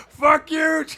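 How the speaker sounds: loud, high drawn-out yell; screaming drunk heckler. Retold or imitated. Drunk fan on his chair heckling [name], screaming. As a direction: bellow it at the ring like a drunk fan. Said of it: "Like, just screaming".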